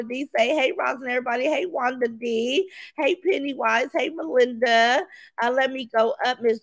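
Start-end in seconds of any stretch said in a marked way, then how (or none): none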